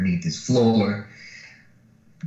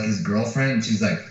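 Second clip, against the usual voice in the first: ordinary talk, nothing marked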